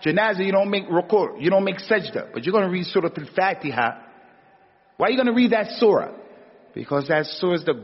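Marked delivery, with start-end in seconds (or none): 5.0-6.1 higher, louder, fast rhetorical question; emphatic preaching. Real, not acted. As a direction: drive the question home to the crowd. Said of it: "rhetorical question to congregation".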